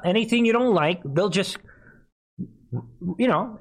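ordinary delivery

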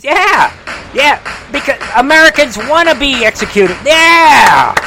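high, loud mock agreement; hammed-up high-pitched incredulous yelling; sarcastic shouting. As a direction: yell yeah with exaggerated sarcasm.

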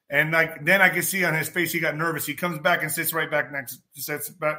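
ordinary talk

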